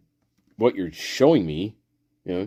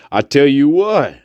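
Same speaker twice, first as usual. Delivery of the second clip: usual speaking voice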